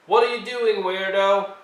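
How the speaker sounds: high, loud jeer; bully shouting an insult at Violet; mocking shout. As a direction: yell it to make fun of her.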